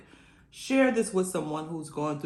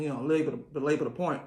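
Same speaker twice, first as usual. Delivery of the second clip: ordinary talk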